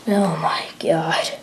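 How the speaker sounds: breathless whispered dread; horrified whisper. Acted. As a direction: barely breathe the words out in shock. Another soldier vanishes.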